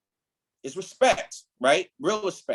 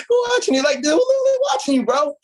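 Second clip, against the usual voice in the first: excited laughing outburst; 5 dB louder than usual. Real, not acted. Laughing at mentees copying leaders.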